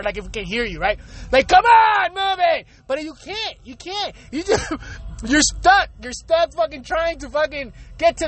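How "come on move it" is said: high, impatient yell at the car ahead; cursing at traffic. Retold or imitated. Stuck driver yelling at the car in front, impatient yell. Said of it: "you're cursing at the fucking car".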